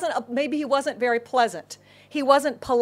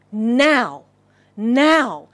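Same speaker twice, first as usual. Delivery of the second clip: louder, drawn-out urgency; urgent insistence; 8 dB louder than usual. Acted. Blind beggar seizes his one chance, urgent and loud. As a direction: seize the moment on each word.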